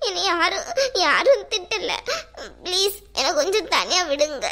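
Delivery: sobbing plea, gasping between phrases; crying. Acted. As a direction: beg through tears to be left alone. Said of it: "என்னடி இப்படி அழுதுட்டு இருக்க".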